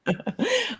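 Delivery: a quick, high laugh; high light laugh. Real, not acted. Host giggles when asked about herself. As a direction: giggle before answering.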